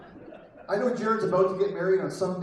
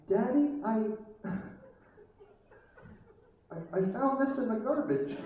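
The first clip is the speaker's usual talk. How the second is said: put-on little child voice; 4 semitones higher than usual. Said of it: "This little voice goes".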